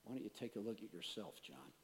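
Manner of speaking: low, very soft retold reproof; God's gentle rebuke. God answering the irritated preacher, quietly reproving. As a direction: drop to a hushed, low voice as God. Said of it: "God says".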